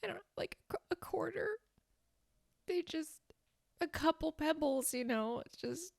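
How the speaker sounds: breathy embarrassed whisper. Acted. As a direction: confess it under your breath. Whispering about pebbles.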